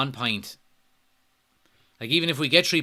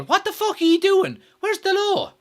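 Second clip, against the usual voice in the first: very high, loud, incredulous yell; real furious disbelief at the defending; exasperated outburst; 6 dB louder than usual. Real, not acted. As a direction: voice shooting up, demanding an answer.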